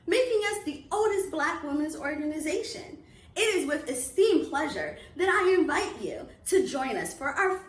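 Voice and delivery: loud, bright announcement energy, like an ad; upbeat promo read. Acted. Club president inviting families to holiday reading series, upbeat promo. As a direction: sell the event to families with big enthusiasm.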